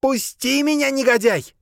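high, loud panting cry; breathless shout. A narrator's reading. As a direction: gasp it out as a shout. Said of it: "задыхаясь, крикнула она".

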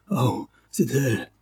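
slow hoarse gasp of realization. Acted. Poisoned duke realizing who did it, slow hoarse cry. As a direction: rasp it out, slowly, as it dawns on him. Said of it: "la voix rauque".

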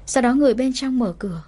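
usual speaking voice

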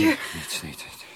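breathless, struggling for air; gasping. Acted. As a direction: choke the words out. (buiten adem, happend naar lucht)

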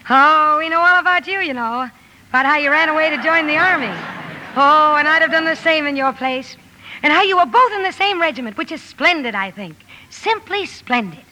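bubbling, breathless admiration; gushing enthusiasm. Acted. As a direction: gush admiringly to new acquaintances. Jo gushes to young soldiers.

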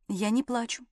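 soft, timid denial; quiet and subdued; meek denial. A narrator's reading. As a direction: deny it softly to a bullying husband. Frightened wife denies crying to husband.

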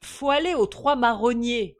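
loud, high tipsy call; hiccuping drunk. A narrator's reading. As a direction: propose it like a merry drunk. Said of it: "hoquetait J B". (ivrogne qui hoquette)

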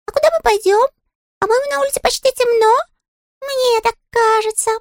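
very high, tiny sulky voice; a small touchy critter, piping high; squeaky little creature. Acted. Щепкин's character voice.

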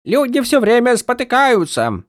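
hammed-up barking of orders; mock drill sergeant. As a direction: parody a grumpy sergeant. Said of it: "стала точной копией Каладина". (пародия на сурового сержанта)

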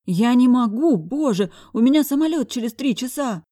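acted fluster of a woman in a bind; panicked pleading. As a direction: plead urgently, pitch pushed up. Woman pleading she cannot stay.

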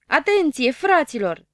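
loud, high call to the group; rally everyone with a shout; Știe tot shouting to the crew before jumping. A narrator's reading. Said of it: "strigă el".

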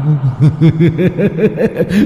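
high, rising creepy laugh; eerie ghost laugh. A narrator's reading. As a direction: cackle like a spirit. Ghost laughs after taunting. (tiếng cười ma quái)

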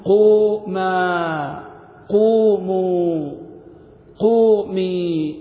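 very slow, long-held vowels; chant-like, elongated grammar forms; drawn-out examples. Shown as a demonstration. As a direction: stretch each form, one at a time. Teacher recites example verb forms.

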